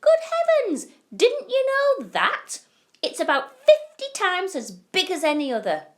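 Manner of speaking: put-on old folks scandalised he didn't know; indignant old grandparents. A narrator's reading. Grandparents scolding Charlie fondly, high loud exclaim. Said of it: "cried all four of them at once".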